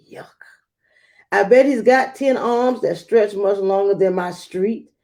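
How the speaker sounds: ordinary delivery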